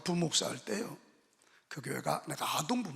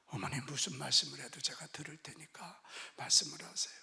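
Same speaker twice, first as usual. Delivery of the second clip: hushed plea; 3 semitones lower, 6 dB quieter than usual. Retold or imitated. Son gently begging mother.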